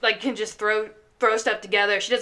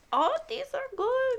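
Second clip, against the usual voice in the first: high-pitched imitation of grandma; grandma impression; 4 dB quieter than usual. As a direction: play a grandma approving the food, pitched up. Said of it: "grandma got the enchilada and was like".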